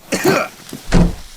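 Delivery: a sharp loud cough forced out; startled cough. Acted. Captive caught off guard, loud pained cough. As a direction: cough as if winded by a blow. Hit or choked during abduction.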